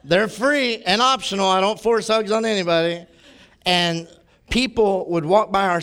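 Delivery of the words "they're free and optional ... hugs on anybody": louder, higher, laughing offer of hugs; playful street invitation; cheerful call-out. Retold or imitated. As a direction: call out free hugs to passers-by. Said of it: "and I'd go".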